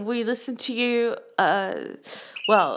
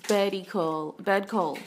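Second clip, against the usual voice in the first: normal talking voice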